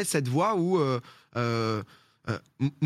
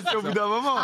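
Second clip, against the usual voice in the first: laughing; 10 semitones higher than usual. Real, not acted. Laughs at own joke.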